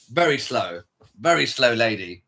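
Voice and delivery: ordinary delivery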